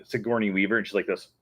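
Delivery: ordinary talk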